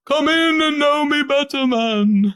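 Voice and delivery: high, hammy ghost of Christmas; lightly voiced jolly ghost.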